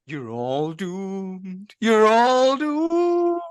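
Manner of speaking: slow, drawn-out doom warning; slow ominous raspy drawl. Acted. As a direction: drag it out like a doomsayer, rising at the end. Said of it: "I was just gonna go".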